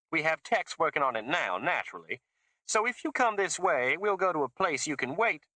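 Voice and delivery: put-on high, gushing Southern accent; a fake Southern accent, high and gushing; fake Mississippi accent. A narrator's reading. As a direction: lay the fake Mississippi on thick. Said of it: "It was fake Mississippi".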